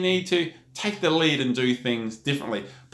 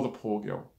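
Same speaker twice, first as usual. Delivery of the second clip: ordinary talk, nothing marked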